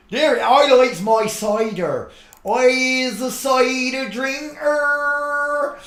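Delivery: high, slow West Country farmer drawl; West Country yokel. Retold or imitated. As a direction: ham up a Somerset cider drinker. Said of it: "He speaks like Wurzel Gummidge".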